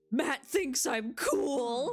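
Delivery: slow, high, dreamy rise; giddy. Acted. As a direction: savour every word, lovestruck. Dad tells daughter, giddy.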